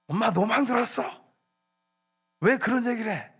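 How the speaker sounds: high-pitched, put-on protest to mom; acted-out indignant child; mock yelling child. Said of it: "그러면서 막 소리를 지르고 엄마한테 윽박지르고".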